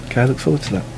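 distant, off-mic voice; sitting back from the microphone; far from mic. Real, not acted. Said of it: "You sound really off mic".

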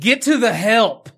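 loud, high, drawn-out movie quote; mock dramatic call. Quoting the movie's best line, a loud mock call. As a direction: belt out the line slowly and high.

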